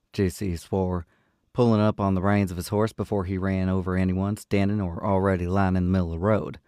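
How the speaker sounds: steady audiobook narration; story narration. A narrator's reading. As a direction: read it like an audiobook narrator, even and clear. Reads the novel aloud.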